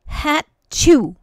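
high, loud playful sneeze; mock sneeze in words. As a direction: act out a big sneeze. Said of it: "she sneezed".

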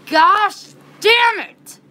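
high, drawn-out furious yell; angry yelling. Retold or imitated. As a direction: re-enact yelling your head off in the car. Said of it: "I was just yelling in, in my car".

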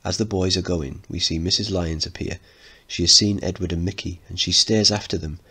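normal talking voice

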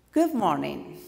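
emphatic good morning, starting high then falling. Shown as a demonstration. Coach models native English pitch, high start. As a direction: launch the greeting from up high. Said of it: "a higher pitch".

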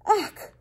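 high, sharp "ach" of exasperation; disgusted exclamation. A narrator's reading. King refusing to hear about rats, high disgusted outburst. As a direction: cut in with a high cry of disgust.

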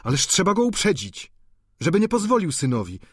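put-on high, hurried protest from a young student; urgent exclamation. A narrator's reading. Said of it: "zawołał Eugeniusz".

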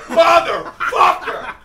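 high, loud, drawn-out curse; tirade outburst. Retold or imitated. Driver venting after the standoff, exasperated yell. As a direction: let the curse rip slowly.

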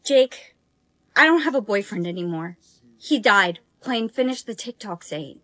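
low, soft and grieving; mournful. Acted. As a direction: mourn the lost boyfriend quietly. Sad about dead boyfriend.

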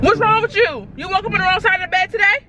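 high, sassy mock ratchet; put-on ratchet. Prankster confronting puzzled fiance, high mock ratchet sass. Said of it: "I will be acting ratchet".

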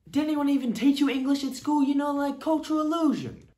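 high, mocking New York voice; New York accent. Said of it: "speaking like you're from New York".

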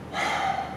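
short, soft, voiceless out-breath; releasing sigh. Shown as a demonstration. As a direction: let the breath go softly. Breathing out to let go.

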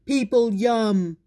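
loud, slow monster voice; deep muffled sea monster. Acted. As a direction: growl it like a hungry beast. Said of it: "roared the sea monster".

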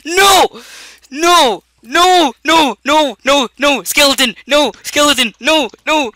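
high, loud, panicked screams of no. Real, not acted. Gamer attacked by a skeleton, screaming in panic. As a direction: yell no over and over in terror.

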